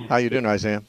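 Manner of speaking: ordinary talk, nothing marked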